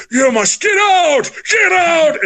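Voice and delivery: high, slow, wailing ghostly command; spooky ghost voice. Retold or imitated. Imitating the ghost on the recording, eerie and high. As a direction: moan it like a haunting spirit. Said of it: "these terrifying voices".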